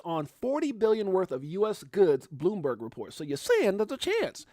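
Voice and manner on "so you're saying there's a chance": a bit higher, only slightly slowed; lighter, less drawn-out repeat of the quote; quicker movie quote. Retold or imitated. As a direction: toss the famous line off again. Quoting the movie line again.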